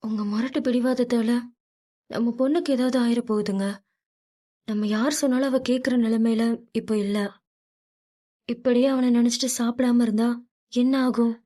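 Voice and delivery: a wife pleading with her stubborn husband, anxious; pleading mother. A narrator's reading. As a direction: plead with him for the daughter's sake. Mother voiced in story.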